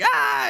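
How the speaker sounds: loud, brief imitated scream; madman's yell, acted out; mock scream. Possessed man runs up screaming.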